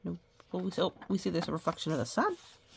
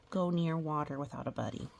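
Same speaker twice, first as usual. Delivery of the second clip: usual speaking voice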